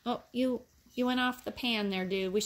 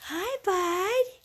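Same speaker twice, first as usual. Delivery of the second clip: much higher, louder cooing at the puppies; 10 semitones higher, 6 dB louder, slower than usual. Real, not acted. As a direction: coo hello to the puppies. Greeting puppies in baby talk.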